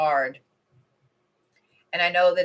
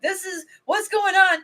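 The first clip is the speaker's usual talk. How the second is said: much higher, squeaky mock panic; imitating panicked friend; 12 semitones higher than usual. Quoting her moderator friend freaking out, squeaky mock panic. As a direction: play the friend freaking out over snow. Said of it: "She was like".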